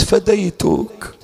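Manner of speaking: slow, tender and drawn out; tender plea. Retold or imitated. Sister tenderly greeting her long-missed brother.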